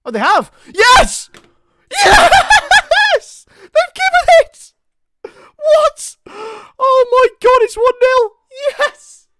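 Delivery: screaming high and loud with joy; real disbelieving yells of delight; ecstatic shout. Real, not acted. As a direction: explode as the goal is given. VAR awards the goal, fan erupts.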